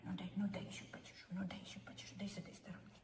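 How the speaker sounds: soft fast whisper to the cat; whispering sweet talk to the cat. Real, not acted. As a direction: coax him in a hush. Whispering to the cat while grooming.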